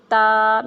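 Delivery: تا with the tongue tip at the tooth roots, said loud and clear; loud careful تا for the class. Shown as a demonstration. Teacher modelling تا for children, loud and clear. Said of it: "میرے ساتھ ساتھ آپ لوگ دہرائیں".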